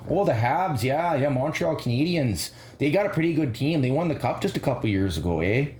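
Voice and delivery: a hint of a Newfoundlander answering; Newfoundland accent. Second hockey fan replies in a light Newfoundland accent. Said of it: "Newfoundland versus Minnesota".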